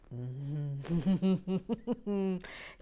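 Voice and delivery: closed-mouth humming chuckle; knowing chuckle. Real, not acted. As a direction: chuckle through closed lips in recognition. Amused by her Lego confession.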